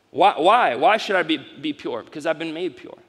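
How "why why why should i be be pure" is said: ordinary delivery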